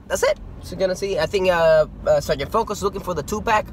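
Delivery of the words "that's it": jumps way up high; high squeak. Real, not acted.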